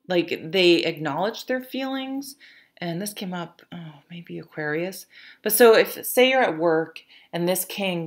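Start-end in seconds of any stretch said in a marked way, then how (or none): 2.8-5.0 softer, unvoiced whisper; a quick private aside. Real, not acted. Reader whispering an aside to viewers about the card. Quiet aside during reading.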